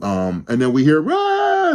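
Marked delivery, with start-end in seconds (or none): 1.1-1.7 high-pitched imitated scream; a shrill panicked scream, retold; mock scream. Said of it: "Thomas Jane's freaking out".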